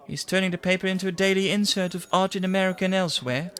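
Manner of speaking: high and quick, lightly mocking; mocking secretary. Secretary mocking the editor's art insert, high and quick. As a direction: rattle it off with a scoff.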